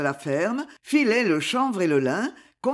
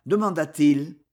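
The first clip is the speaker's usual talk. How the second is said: ordinary delivery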